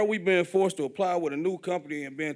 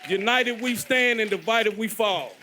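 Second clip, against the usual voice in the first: rallying slogan; 5 semitones higher, 5 dB louder, slower than usual. Real, not acted.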